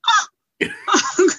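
high, loud shocked gasp of oh; a loud high mock-shocked oh. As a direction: squeal it out in disbelief. Reacting to haters, exclaiming.

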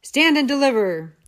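loud thundering highwayman command. Acted. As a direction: roar it like a highwayman halting a coach. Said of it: "who thundered".

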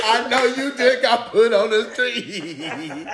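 slow taunt drawn out into laughter; playful jeer through laughs; mock laughing taunt. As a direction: stretch the last word into a laugh. Acting out laughing at someone's misfortune.

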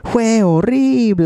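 high, slow, drawn-out mock wail; imitating a song's dude. Imitating the dude from a song for friends, high and drawn-out. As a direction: stretch it out high, hammed-up. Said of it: "it's like the dude, he's like".

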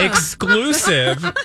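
slow, strained and laughing. Real, not acted. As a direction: push it out through a laugh. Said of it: "I just choked on my own outrage".

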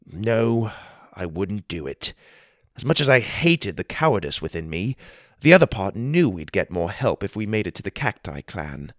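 plain reading voice

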